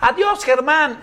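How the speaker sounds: high calling out to him; sing-song shouts of goodbye from a crowd; mock crowd yelling. Crowd outside the theatre calling goodbye, high mock yell. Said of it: "me empiezan a gritar".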